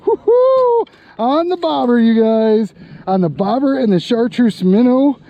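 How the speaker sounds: high, loud whoops over a big fish; thrilled high shouting at the catch; excited. Real, not acted. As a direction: yell with real thrill as the fish comes in. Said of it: "I'm so excited".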